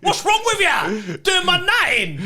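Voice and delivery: high, drawn-out Cockney yell; angry Cockney. Retold or imitated. Imitating dad ranting at mum, angry Cockney. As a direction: rant like an exasperated Cockney dad. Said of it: "he turns Cockney".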